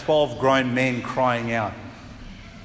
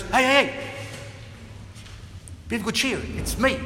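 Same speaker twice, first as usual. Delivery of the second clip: higher, quick calling out hey; urgent reassurance; 8 semitones higher, 3 dB quieter than usual. Acted. Jesus to the screaming disciples, quick higher reassurance. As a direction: call out to calm them fast. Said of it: "Jesus goes".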